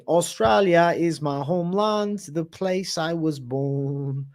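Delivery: slow, drawn-out fake Australian accent; hammed-up, slow Australian accent. Shown as a demonstration. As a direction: stretch it out in a bad Australian accent. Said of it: "best Australian accent".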